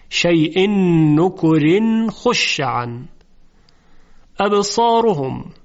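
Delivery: slow recited verse; Quran recitation. Shown as a demonstration. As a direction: recite slowly.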